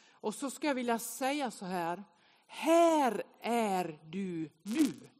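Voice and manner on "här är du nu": very drawn-out, measured declaration; slow proclamation. Acted. Preacher placing the congregation inside a red ring, slow proclamation. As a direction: stretch every word out as an announcement.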